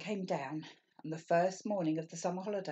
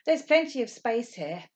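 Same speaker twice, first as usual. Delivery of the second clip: calling out; 6 semitones higher, 8 dB louder than usual. Acted. Mummy calling out in park.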